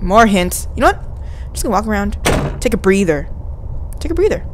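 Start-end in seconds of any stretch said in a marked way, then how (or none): 4.0-4.4 quick, high-pitched echo; high repeat. Real, not acted. Player telling self to rest, high little echo.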